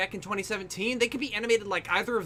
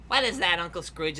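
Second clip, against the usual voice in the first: ordinary talk, nothing marked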